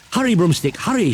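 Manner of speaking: high, loud hurry; urgent shout. Acted. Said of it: "shouted Higgity Haggity".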